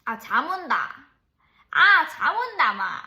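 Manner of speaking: loud, drawn-out Gyeongju dialect whine; sleepy dialect grumble. Shown as a demonstration. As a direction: groan it out loud like a sleepy local. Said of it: "이렇게 해야죠". (경상도 사투리로 크게 늘어지는 졸린 투정)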